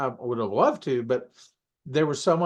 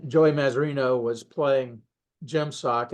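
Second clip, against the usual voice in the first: normal talking voice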